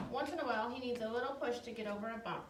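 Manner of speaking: ordinary talk, nothing marked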